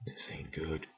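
hushed, unvoiced under the breath; whispered worry. Real, not acted. Gamer sneaking past guards, whispering worriedly. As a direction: whisper so the enemies won't hear.

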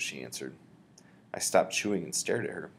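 usual speaking voice

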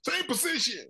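mock referee order. Referee orders restart again.

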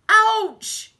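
very loud, high yelp; sharp pained shout; cry of pain. A narrator's reading. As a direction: yelp as if bitten. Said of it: "cried Hugh".

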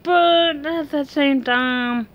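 high, pressing protest on but at the same time. Real, not acted. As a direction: push the point up high, insisting. Heated argument about neighbor.

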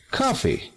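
"coffee" stressed on COF; first-syllable stress. Shown as a demonstration. Teacher reading out examples, "coffee" accented on first syllable. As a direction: hit the first syllable of "coffee", let it drop. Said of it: "el acento en la primera sílaba".

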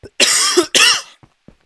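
sharp, loud throat-clearing cough. Real, not acted. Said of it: "I do apologize if I sound a bit rusty".

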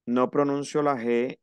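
ordinary talk

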